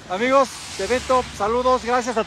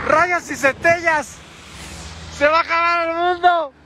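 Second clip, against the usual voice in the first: mock dramatic cry; 7 semitones higher than usual.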